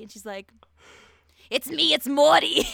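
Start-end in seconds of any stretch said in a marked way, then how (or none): 1.5-2.7 loud, slow, terrible cartoon impression; Morty. Podcaster mimics girl's failed TikTok audition, bad loud impression. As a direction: belt out a badly faked character voice, higher pitched. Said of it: "really good Rick and Morty impression".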